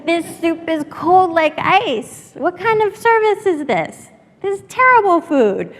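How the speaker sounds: high, loud, slow complaining rant; loud drunk complaint. Retold or imitated. Drunk customer complains loudly to the owner about cold soup. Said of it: "he starts saying".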